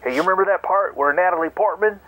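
ordinary delivery

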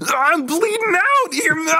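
exaggerated Muppet imitation of a bleeding movie character; Muppet-voice impression. Friend mocking an actor's bleeding-out scene, in a high Muppet voice. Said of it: "Hey, do a Muppet voice".

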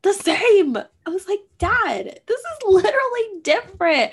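high, loud frustrated outburst at dad; near-shouting at dad that it's not the same; upset outburst. Retold or imitated. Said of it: "getting so upset".

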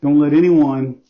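earnest spoken prayer to God; closing prayer. Acted. Pastor praying over the congregation, earnest prayer. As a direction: pray sincerely for the lost listener.

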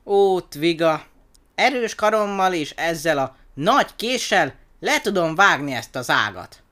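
high, loud, swelling with pride; puffed-up little show-off, loud and high; proud boast. A narrator's reading. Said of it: "büszke hangon mondta".

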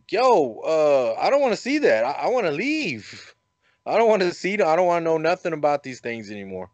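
high, rushed and rattled, begging to leave; retold panic, voice jumping up; scared pleading. Spooked man begging friend Brandon to stop showing pictures, high and rushed. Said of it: "I'm like".

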